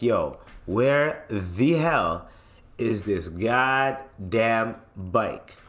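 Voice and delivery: stretched out, very slow; slow frustrated drawl. Retold or imitated. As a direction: drag each word out in disbelief. Can't find his bike.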